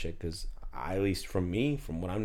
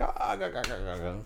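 imitated group laughter; faster than usual. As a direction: imitate a crowd laughing at someone. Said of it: "they all started laughing".

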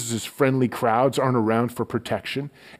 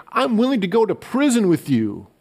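bold pledge; 9 semitones higher, 4 dB louder than usual. Acted. Peter's bold pledge to Jesus.

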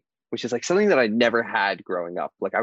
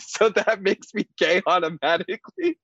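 high-pitched, amused comeback; retold incredulous retort, close to laughing; mock incredulity; 9 semitones higher than usual. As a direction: question the logic in playful disbelief. Acted comeback to dad's stereotype.

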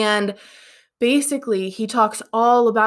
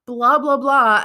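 mock flash sale hype; 6 semitones higher than usual. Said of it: "and I'd be like".

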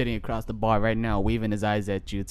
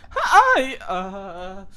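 hi cracks up very high and loud; voice cracking; 7 semitones higher, 5 dB louder than usual. Retold or imitated. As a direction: crack the voice on hi with a laughing squeak. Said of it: "His voice just cracked".

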